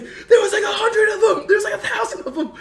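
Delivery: freaked-out excitement. Retold or imitated. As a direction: blurt it out, laughing and shouting in shock. Cousin freaking out over photo.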